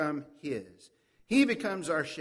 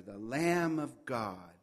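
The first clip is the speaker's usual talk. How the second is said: ordinary delivery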